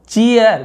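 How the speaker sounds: चीयर loud, stretched into "CHEE-yar"; long two-part चीयर; drawn-out ending. Shown as a demonstration. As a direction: punch चीयर high, then let it fall. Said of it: "ईयर ऐसा लंबा खींच रहे हैं".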